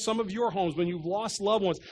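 ordinary talk

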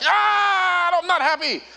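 high, loud imitated wail of protest; freaked-out child. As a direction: scream it out like an upset kid. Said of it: "he freaked out".

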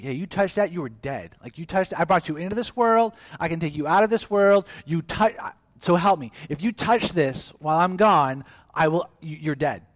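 angry parent threat. Retold or imitated. Preacher voicing a parent threatening a disobedient child, mock angry. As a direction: scold like a fed-up parent warning a child. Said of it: "what you may say as a parent".

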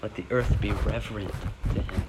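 soft, hushed reading; reverent hush. Acted. Preacher reading the verse to the congregation as a hushed reverence. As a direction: speak it in awe. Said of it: "And that says".

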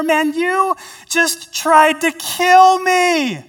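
high, loud, slow indignant complaint; mock accusation from the pit; resentful retort. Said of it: "respond in an alternative manner".

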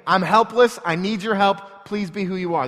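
loud, high cry for help. Acted. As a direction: proclaim helplessness at full voice. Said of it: "a shout to him".

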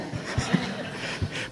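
unvoiced wheezing laugh; breathless silent laugh. Real, not acted. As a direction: laugh without voice. Laugh after asking landlord.

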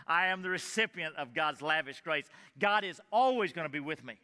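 ordinary delivery

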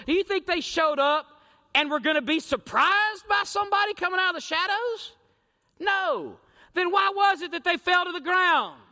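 rising to a full-voiced shout; preaching shout. Real, not acted. Preacher shouting at the congregation, loud and rising. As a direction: drive the question home loudly.

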